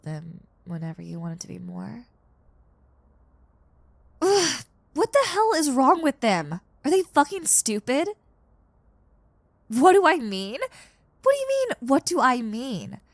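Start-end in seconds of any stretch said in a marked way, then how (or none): 4.2-8.1 high, loud, furious on a friend's behalf; indignant outburst. Acted. 9.7-13.0 incredulous retort. Acted. Incredulous at friend's question.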